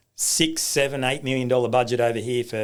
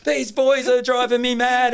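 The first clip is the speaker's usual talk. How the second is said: much higher, louder and slow, imitating his fed-up wife; exasperated wife; 13 semitones higher, 6 dB louder than usual. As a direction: play the wife at the end of her rope, drawn out. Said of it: "there's been times when she goes".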